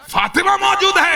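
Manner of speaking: high, ringing defiance; defiant declaration. Acted. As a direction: stand firm against the intruders. Acted defiance of the intruders.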